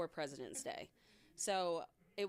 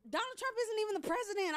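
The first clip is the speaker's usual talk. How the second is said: exasperated teacher; 14 semitones higher, faster than usual. Retold or imitated. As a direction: correct the kids in disbelief.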